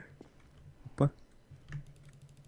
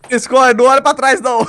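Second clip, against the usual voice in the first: high panicked shout to run; scared horror game streamer; 8 semitones higher, 7 dB louder than usual. Real, not acted. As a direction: yell a frantic warning. Urging friend to flee. (gritando em pânico)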